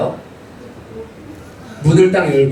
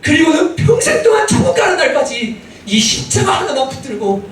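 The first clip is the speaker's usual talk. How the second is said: fervent preaching; 10 semitones higher than usual. Acted. Pastor urging the congregation to cling to the cross, fervently. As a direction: preach it out to the whole church.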